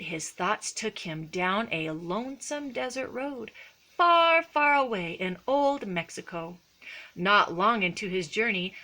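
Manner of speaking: ordinary delivery, nothing marked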